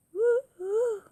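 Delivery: high loud owl hooting. Acted. Owl hooting at the Gruffalo's child, high and loud. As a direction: hoot like an owl.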